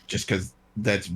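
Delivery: usual speaking voice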